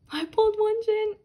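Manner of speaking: high, loud happy cry; thrilled cry. Real, not acted. Fan crying out happily to viewers about [name]. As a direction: announce the pull with delight. Thrilled at rare card pull.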